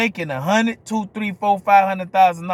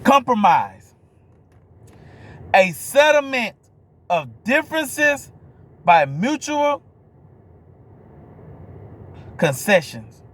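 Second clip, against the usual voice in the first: slow, high-pitched, emphatic definition reading; dictionary read-out; 4 semitones higher, slower than usual. Real, not acted. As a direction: read it out phrase by phrase with big pauses.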